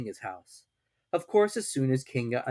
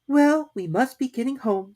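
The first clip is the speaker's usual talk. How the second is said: high-pitched character voice; Kanga; 13 semitones higher, 4 dB louder than usual. A narrator's reading. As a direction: voice her high, well above the narration. Kanga's line in read story.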